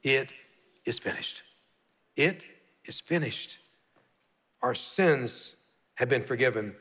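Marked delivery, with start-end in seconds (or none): 0.0-3.6 breathy hush, slowing on the last take; hushed dying words. Acted. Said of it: "last words that Jesus said on the cross".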